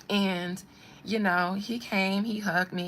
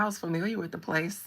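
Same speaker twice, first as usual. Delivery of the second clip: ordinary talk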